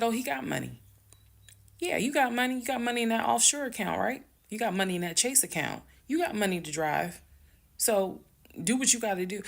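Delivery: high, fast sarcastic yelling. Retold or imitated. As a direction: yell it mockingly at him. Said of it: "I'm yelling in the background".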